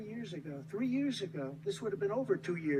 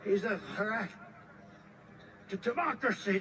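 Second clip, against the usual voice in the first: lightly mocking impression of an opponent; mock his catchphrase, drawing out "threat"; Joe Biden; 3 semitones higher than usual. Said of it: "He always says".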